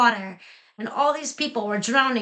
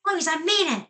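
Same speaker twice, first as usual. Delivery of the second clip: raised, higher-pitched scolding; mild shout; 7 semitones higher, faster than usual. A narrator's reading. Tutor shouts at Eloise in their lesson, mild high-pitched shout. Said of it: "then he shouts".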